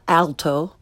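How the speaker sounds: "alto" starting like English Al; learner's mispronunciation. Shown as a demonstration. Teacher shows beginners' mistake, "alto" with English short a. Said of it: "first the way most people".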